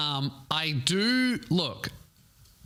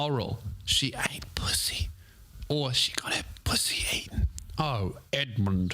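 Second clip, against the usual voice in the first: whisper; 2 semitones lower than usual. Acted. Whispering the gossip aside.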